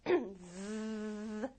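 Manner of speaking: a long buzzing 즈, voice switched on; voiced ending sound. Shown as a demonstration. Teacher showing students the voiced ending, a buzzed 즈. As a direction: hold 즈 as a sustained z hum. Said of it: "울리면 얘도 울려서".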